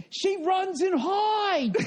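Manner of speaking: high, slow, drawn-out mock cry; imitating Corrielli. Comedian mocking the host who vanished, high slow wail. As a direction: stretch it out high like a wounded complaint. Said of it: "he goes".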